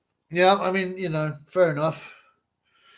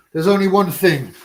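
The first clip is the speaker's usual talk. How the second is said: ordinary delivery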